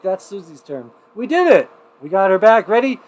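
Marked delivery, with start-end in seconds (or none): 1.1-3.0 higher and louder, celebrating the fix; excited cheer. Real, not acted. Host cheering to viewers that Oregon rejoined, excited. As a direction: cheer that the guest is back. Reconnected guest, starting countdown.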